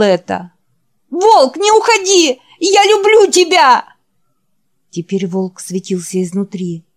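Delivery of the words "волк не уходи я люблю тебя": very high, loud crying plea; desperate plea. Acted. Jack begging the vanishing Wolf to stay, very high loud cry. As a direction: beg him not to go.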